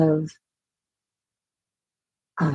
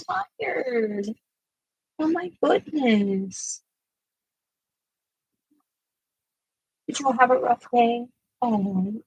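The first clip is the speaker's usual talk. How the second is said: higher, gentle sing-song pet talk; talking to the dogs; 6 semitones higher, 5 dB quieter, faster than usual. Real, not acted. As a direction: soothe the restless dogs like babies. Comforting the tired dog.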